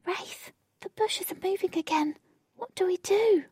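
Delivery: high-pitched, wary character voice; cautious. A narrator's reading. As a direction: ask it cautiously, pitched well up. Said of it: "Jane cautiously asked".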